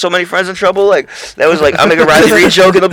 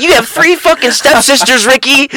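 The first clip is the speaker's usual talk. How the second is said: high-pitched imitation of an angry woman; mock a woman freaking out, pitched way up; retold freak-out; 10 semitones higher, 3 dB louder than usual. Friend retells a woman confronting Ricky, high mock outrage. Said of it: "she was freaking the fuck out".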